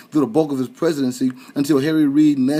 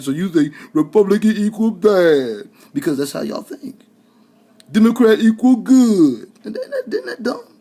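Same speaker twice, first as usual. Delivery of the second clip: sarcastic, rising mockery; mock them with a sneer, building up; facetious; 7 semitones higher, 6 dB louder than usual. Mocking listeners' thinking, sarcastic and rising. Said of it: "I'm being facetious".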